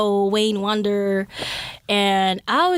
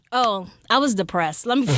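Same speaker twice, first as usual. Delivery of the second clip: usual speaking voice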